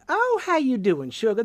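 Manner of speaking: high, loud Southern swing, pitch going up and down; sing-song Southern lilt. Shown as a demonstration. Southern-born guest showing the cadence of Southern speech, exaggerated high lilt. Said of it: "sort of going up and down like that".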